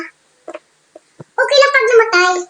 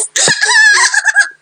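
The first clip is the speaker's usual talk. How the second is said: shrieking laugh; 12 semitones higher than usual. Real, not acted. Bursts out laughing.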